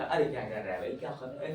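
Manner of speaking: low, laughing अरे क्या कर रहा है; amused teasing rebuke; chuckling at the student; laughing scold. Real, not acted. Teacher mock-scolding student.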